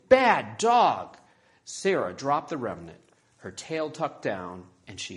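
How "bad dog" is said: loud, high shouted scolding; exploding anger. A narrator's reading. Isabella to the puppy amid the wreckage, shouting angrily. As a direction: shout the dog down in fury. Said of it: "her anger just exploding".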